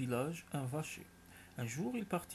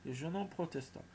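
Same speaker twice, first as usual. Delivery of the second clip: usual speaking voice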